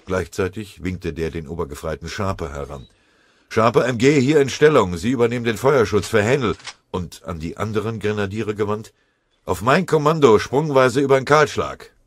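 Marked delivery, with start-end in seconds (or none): 3.5-6.6 high, loud military order; barked command. A narrator's reading. Sergeant giving orders in combat. 9.4-11.9 high, loud military order; barked command. A narrator's reading. Sergeant giving orders in combat.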